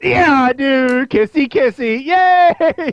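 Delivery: high, loud, slow gleeful squeal; giddy admission. Retold or imitated. Terra admitting her crush, high and gleeful. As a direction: gush it out and cheer. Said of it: "She turns around and goes".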